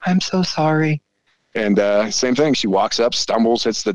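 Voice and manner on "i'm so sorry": whiny apology. Retold or imitated. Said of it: "And she starts".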